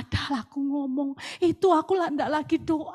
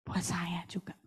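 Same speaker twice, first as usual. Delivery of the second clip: whispered confession; softly whispered admission; 10 semitones lower, 14 dB quieter than usual. Real, not acted. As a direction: drop to a private whisper. Confessional aside.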